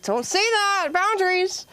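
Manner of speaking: very high, prim scolding voice; flustered teacher. Retold or imitated. Teacher scolding her student, very high flustered voice. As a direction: squeak out a flustered reprimand. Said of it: "she was like".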